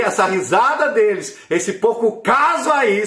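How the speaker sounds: high, loud impassioned prayer; fervent prayer. Retold or imitated. Praying about the mockers, loud impassioned prayer. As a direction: cry out to God with passion.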